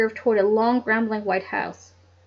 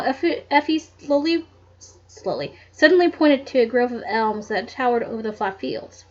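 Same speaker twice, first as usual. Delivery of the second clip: ordinary delivery, nothing marked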